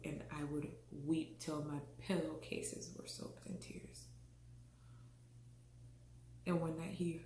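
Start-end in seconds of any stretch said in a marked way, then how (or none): 0.0-4.0 hushed whisper, fading out. Real, not acted. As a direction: let the words sink to a whisper. Tearful confession of IVF struggle.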